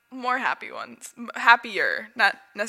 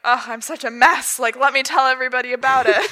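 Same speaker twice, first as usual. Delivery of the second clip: louder, hammed-up self-pity, a bit fast; mock dramatic wallowing; 3 semitones higher, 9 dB louder than usual. Friend mocking her dramatic younger self, hammed-up and loud. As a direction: play up the misery for an audience. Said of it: "being dramatic".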